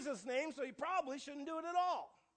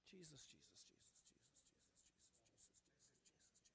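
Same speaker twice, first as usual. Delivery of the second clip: soft breathy whispered Jesus, Jesus; muttered under her breath; 11 semitones lower, 28 dB quieter than usual. Retold or imitated. Older lady whispering Jesus under her breath at church. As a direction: whisper the name over and over to yourself. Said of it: "under her breath".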